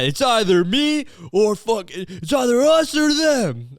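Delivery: high, slow, hammed-up demand; over-the-top high mock ultimatum. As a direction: mimic the one forcing a choice. Mocking the ultimatum again.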